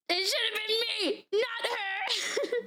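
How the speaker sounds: high, hammed-up jealous wail; mock jealous outburst. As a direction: protest in mock heartbreak. Mock jealousy over a game character.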